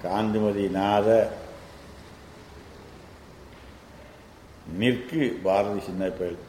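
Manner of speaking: quick chanted reading of the Tamil lines; rapid verse recitation. Shown as a demonstration. Teacher reciting the verse to his student, fast and chanted. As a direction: run through the verse at speed.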